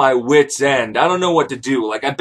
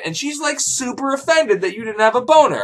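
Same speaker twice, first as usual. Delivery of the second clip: ordinary talk, nothing marked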